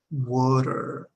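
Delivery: water ending in a strong er sound; American water with a stretched r ending. Shown as a demonstration. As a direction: lean into the er at the end of water. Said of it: "terminamos er".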